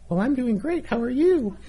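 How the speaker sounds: high, squeaky sing-song princess voice; bright squeaky sing-song welcome, very high. Retold or imitated. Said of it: "working on your princess voice".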